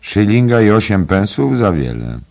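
emphatic dry verdict, loud and high; dry remark. A narrator's reading. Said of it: "powiedział sucho". (sucho, ale dobitnie)

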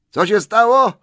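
high, loud call. A narrator's reading. Bilbo calls to the wailing Gollum, loud and high. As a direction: shout it across the dark. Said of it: "zawołał Bilbo".

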